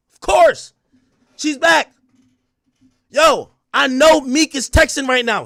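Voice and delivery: excited yelling. Real, not acted. Streamer hyped at the verdict, yelling to chat. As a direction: yell it out, thrilled.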